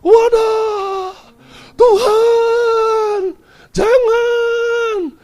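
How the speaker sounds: loud, very high drawn-out wail; acted wailing. Pastor cries out as his feet are held, acted wail. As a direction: cry out to God as if in agony, imitated. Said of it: "Sama nangis".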